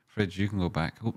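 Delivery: ordinary talk, nothing marked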